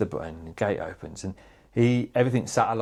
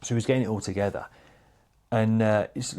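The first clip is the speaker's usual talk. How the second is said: ordinary talk, nothing marked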